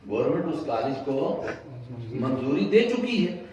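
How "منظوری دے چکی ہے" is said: rising preacher's challenge. Acted. Preacher builds to rhetorical question.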